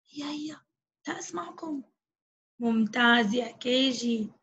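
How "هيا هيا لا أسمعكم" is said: soft coaxing. Acted. Teacher coaxing children.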